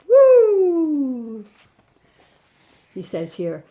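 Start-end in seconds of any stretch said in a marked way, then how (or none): 0.1-1.5 loud high whoop; excited cheer. Acted. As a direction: cheer the astronauts on. Astronaut bears celebrating.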